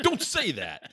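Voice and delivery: high, sharp mock scold; scolding boss. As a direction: squeak it like an upset boss.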